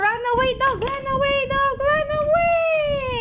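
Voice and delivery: high, excited run away; excited urging. Pretend. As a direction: cheer the toy dog to escape. Urging toy dog to flee.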